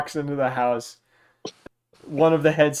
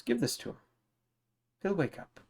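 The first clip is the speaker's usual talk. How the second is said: higher, softer healer voice; lightly voiced kindly healer, higher and soft; Callista; 6 semitones higher, 7 dB quieter than usual. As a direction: hand it over gently, pitched up. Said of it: "she offers it to you and says".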